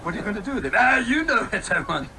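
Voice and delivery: Fat Bastard voice. Retold or imitated. Joking Fat Bastard wants Anakin, mock Scottish. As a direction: ham up a greedy Scottish glutton. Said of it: "Fat bastard".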